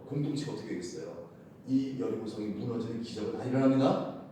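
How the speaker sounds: ordinary talk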